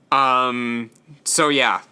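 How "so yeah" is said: ordinary delivery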